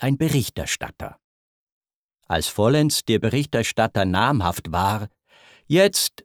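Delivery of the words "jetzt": pleased jetzt. Acted. King's satisfied exclamation.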